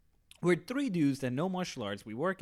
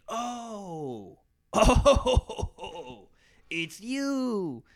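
laughing; 5 semitones higher, 6 dB louder, much slower than usual. Retold or imitated. Mister Late recognizes the kid.

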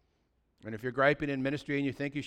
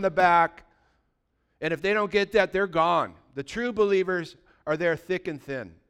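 louder, rebuking preaching; forceful preaching; 5 semitones higher, 7 dB louder than usual. Acted. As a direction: call them out from the pulpit.

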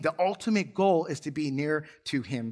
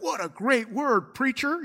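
mock praise; 6 semitones higher, slower than usual. Mock flattering church audience.

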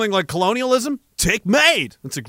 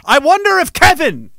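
high, raised voice; pointed call-out; 8 semitones higher, 6 dB louder than usual. Acted. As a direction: project it so Kevin hears in the back. Pointedly calls toward Kevin in back.

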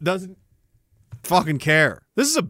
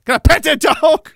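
defiant mock shout; 7 semitones higher, 6 dB louder than usual. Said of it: "that's what I'm gonna start saying".

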